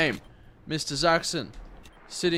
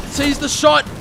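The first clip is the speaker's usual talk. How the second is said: excited shout; 12 semitones higher, 13 dB louder than usual. Real, not acted. Sudden shot in the match.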